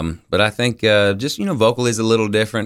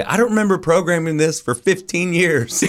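higher-pitched imitation of a complaining boss; irked program director; 9 semitones higher than usual. Program director phoning the DJ about the playlist, mock complaint. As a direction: mimic a boss's complaint, voice lifted.

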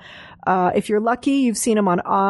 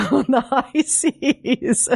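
laughing; 5 semitones higher, much slower than usual. Real, not acted. Laughing repeat of guest's joke.

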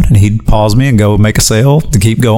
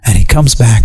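normal talking voice

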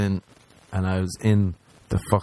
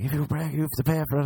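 high whiny voice; 7 semitones higher, faster than usual. Retold or imitated. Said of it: "he's been like".

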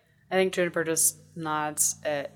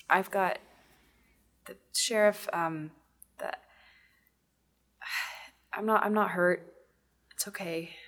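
halting, dazed delivery with long pauses; groggy, mentally exhausted. Acted. Juniper thanks her rescuer and insists she is okay, groggy and drained. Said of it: "groggy, not in the tired way, but in the mentally exhausted way".

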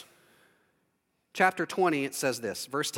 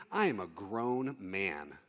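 low, slow and much softer, wounded pride; indignant inner thought; 10 semitones lower, 9 dB quieter, slower than usual. Pretend. Thinking at the person giving thumbtack advice, low indignant mutter. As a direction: mutter it to yourself, slow and offended.

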